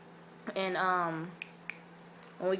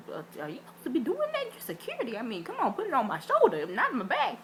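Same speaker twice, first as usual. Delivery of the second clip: higher, indignant telling-off; sassy scolding; 6 semitones higher than usual. Retold or imitated. Telling off security for touching her back, sassy. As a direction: set him straight with sass.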